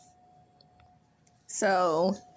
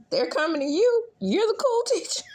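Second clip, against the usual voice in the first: very high and louder mocking taunt; shrill sarcastic jab; 6 dB louder than usual. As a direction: throw it at him mockingly. Sheminta's sarcastic dig.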